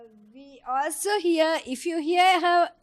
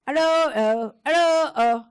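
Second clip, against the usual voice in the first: lightly imitating a toddler's repeated sing-song tune; child's jargon; 6 dB louder than usual. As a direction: echo the child's babble a touch louder. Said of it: "She's saying".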